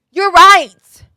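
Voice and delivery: loud, high, hammed-up concession; exaggerated agreement. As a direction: cry out that the scolding is deserved. Exaggerated concession to doctor's scolding.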